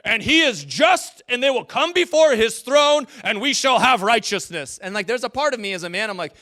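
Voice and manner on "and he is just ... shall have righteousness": loud, high, thundering mock theologian; booming theologian. Preacher imitating mid-century theologians, booming mock proclamation. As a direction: proclaim it like a stern medieval preacher. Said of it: "they're just like".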